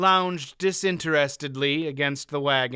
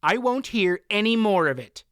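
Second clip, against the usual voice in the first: louder, final; firm announcement; 6 semitones higher, 4 dB louder than usual. Acted. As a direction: shut it down firmly. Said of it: "Faldor announced".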